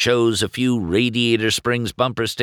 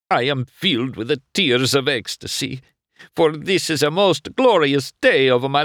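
excited Italian shopkeeper; 8 semitones higher, 4 dB louder than usual. Acted. Luigi delighted by his first customer, Italian accent. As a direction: savour each word like a proud Italian.

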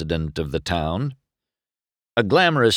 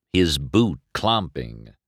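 usual speaking voice

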